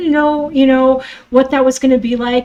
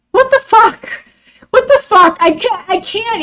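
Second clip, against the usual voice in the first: higher, louder what the fuck, twice; outraged disbelief; 9 semitones higher, 3 dB louder than usual. Real, not acted. Vlogger outraged at her stepmother, loud disbelief. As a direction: spit it out in disbelief.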